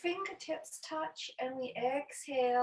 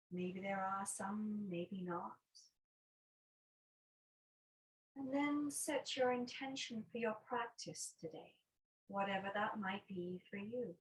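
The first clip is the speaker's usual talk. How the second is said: guided meditation. Acted. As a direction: guide them unhurriedly into noticing the breath. Leads a breathing meditation.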